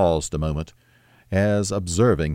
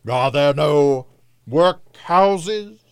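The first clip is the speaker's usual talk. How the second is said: loud, high, drawn-out question; accusing spirit; 8 semitones higher, 4 dB louder, much slower than usual. A narrator's reading. The spirit turns Scrooge's own words on him, accusing. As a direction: throw it back louder, climbing high at the end. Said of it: "turning on him for the last time with his own words".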